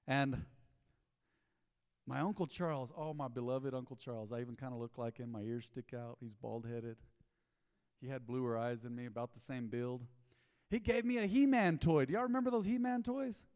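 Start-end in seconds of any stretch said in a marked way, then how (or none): none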